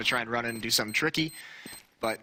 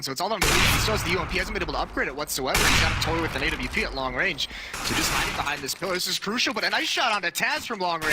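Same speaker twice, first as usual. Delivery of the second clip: esports caster; 4 semitones higher than usual. Real, not acted. Live play-by-play of the clutch.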